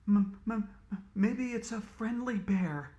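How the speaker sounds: plain reading voice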